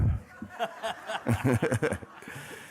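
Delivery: a burst of real laughter; laughing. Real, not acted. Telling the crowd about the phone call, real laughter. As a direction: laugh it out, amused. Laughs at his own story.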